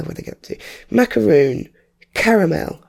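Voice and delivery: ordinary talk, nothing marked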